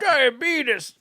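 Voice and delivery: high, playful old-man impression; Wilford Brimley. Teller mimicking the booker's lookalike, light old-man voice. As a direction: toss off the catchphrase like the old actor. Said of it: "he looked like Wilford Brimley".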